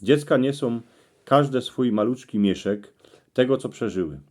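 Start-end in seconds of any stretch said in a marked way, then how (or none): none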